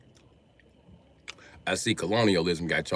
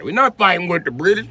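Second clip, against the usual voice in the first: higher, louder scared-colonist quote, lightly voiced; mock scared colonist; 10 semitones higher, 8 dB louder than usual. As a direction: ham up a panicky colonist, "not" pushed up. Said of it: "They're like".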